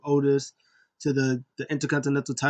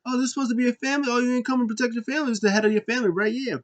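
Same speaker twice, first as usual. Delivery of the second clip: fast, higher sneering taunt; mock heel taunt; 9 semitones higher, much faster than usual. Heel mocking Roman's family, fast high sneer. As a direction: rattle off the jeers quickly.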